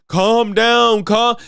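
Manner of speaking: mocking soft-man impression. Commentator mimicking the debater's put-on calm, high and mocking. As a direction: mimic a wimpy plea to calm down. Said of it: "you're talking like a bitch".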